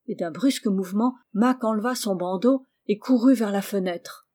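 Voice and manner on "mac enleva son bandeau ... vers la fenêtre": plain reading voice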